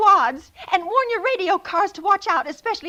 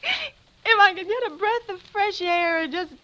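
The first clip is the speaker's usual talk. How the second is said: tearful longing. Acted. Bedridden, longing to get out.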